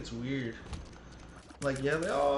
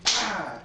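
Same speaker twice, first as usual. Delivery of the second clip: mock yell; 7 semitones higher, 7 dB louder than usual. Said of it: "he'd be like".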